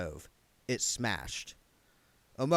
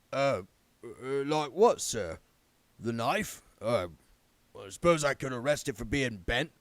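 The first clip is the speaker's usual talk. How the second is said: lightly voiced nervous captain, stammering; a hint of a flustered guardsman fumbling for an answer; mildly voiced captain answering his lord; hesitant guard captain.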